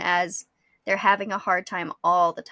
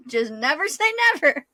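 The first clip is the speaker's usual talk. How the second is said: laughing it out, high and loud; amused, laughing as she turns the saying back; 11 semitones higher, 4 dB louder than usual. Real, not acted. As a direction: chuckle the phrase with a laugh.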